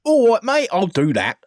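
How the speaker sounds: hammed-up English accent, like a London bloke; mock English accent. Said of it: "you need to say this in an English accent".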